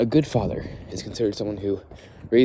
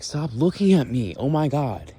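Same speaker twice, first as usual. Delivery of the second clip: drawn-out, higher, louder exasperation; exasperated mock whine; 5 semitones higher, 4 dB louder, slower than usual. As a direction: act fed up with the staring kid. Mocking the staring kid.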